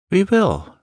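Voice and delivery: quick high eager yes; prompt. A narrator's reading. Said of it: "agreed Ida promptly".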